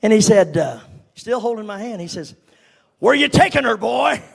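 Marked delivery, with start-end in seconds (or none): 3.0-4.2 put-on a gruff old man's challenge, raised; suspicious uncle confronting a nervous teen. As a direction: bark the question at the boy. Uncle challenges boy in car.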